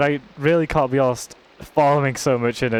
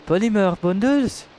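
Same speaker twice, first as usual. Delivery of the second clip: higher, thrilled repeat; excited; 7 semitones higher than usual. Real, not acted. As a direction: exclaim it with delight.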